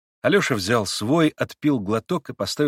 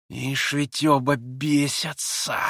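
slow hissing sneer; hissed; 4 semitones higher, much slower than usual. A narrator's reading. Rakitin hisses at the two of them. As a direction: hiss it through contempt. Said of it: "прошипел Ракитин".

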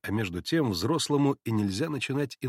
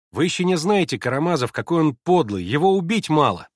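put-on raised, louder boyish accusation; heated boy; 4 semitones higher, 6 dB louder than usual. A narrator's reading. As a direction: press the charge like a boy. Мальчик с горящими глазёнками обвиняет. (горячая мальчишеская речь)

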